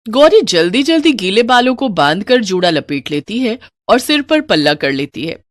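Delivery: usual speaking voice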